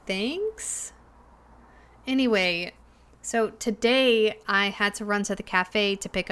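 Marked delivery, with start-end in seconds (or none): 0.1-0.9 high questioning, softer "thanks?"; not sure whether to be offended; unsure thanks. Acted. Friend's backhanded remark about being unspecial.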